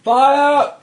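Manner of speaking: high, loud shout; shouted alarm. A narrator's reading. Someone raising the alarm on the ship, shouting. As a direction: yell the alarm across the docks. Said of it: "Someone shouts".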